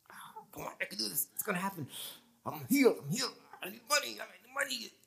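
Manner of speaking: strained, quiet, through clenched teeth. Retold or imitated. Preacher mimics believers straining through clenched teeth. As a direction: grit your teeth and force it out. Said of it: "we clench our teeth".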